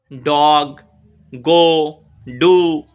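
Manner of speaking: ডগ, গো, ডু each as one firm beat; one-beat words. Shown as a demonstration. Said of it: "এখানে একটা মাত্র স্বর রয়েছে". (ডগ, গো, ডু আলাদা করে জোরে এক দমে)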